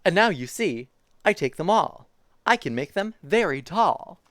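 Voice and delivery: lively picture-book reading voice; reading to children. Acted. Streamer reading a zoo picture book to chat, bouncy storytime voice. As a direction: read it like storytime for little kids.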